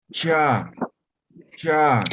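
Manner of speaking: "cha" with tongue behind the upper teeth; palatal cha. Shown as a demonstration. Teacher shows the class the palatal "cha".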